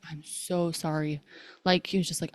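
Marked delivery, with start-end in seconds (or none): 0.1-1.2 low, soft, slow retold apology; imitating his contrite morning-after apology, hushed and drawn out; remorseful apology. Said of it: "he is so remorseful in the morning".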